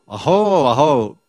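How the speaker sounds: loud, joyful cry of aho; ecstatic aho. Retold or imitated. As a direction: call out aho in wonder. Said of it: "They're saying aho".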